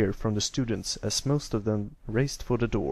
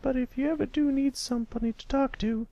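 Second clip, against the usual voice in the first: gentle teacher; 14 semitones higher than usual. A narrator's reading. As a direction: comfort a grieving pupil kindly. Teacher comforts grieving pupil.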